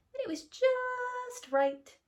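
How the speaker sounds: very high and slow; just right. A narrator's reading. Narrator relishing the perfect bed, very high and slow. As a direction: float it up, stretched and pleased.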